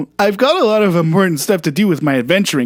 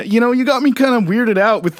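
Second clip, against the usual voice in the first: ordinary talk, nothing marked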